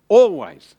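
ALWAYS punched loud and high; emphatic. Real, not acted. Preacher drilling the congregation on one word, emphatic ALWAYS. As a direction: hit ALWAYS hard, falling away at the end.

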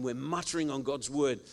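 usual speaking voice

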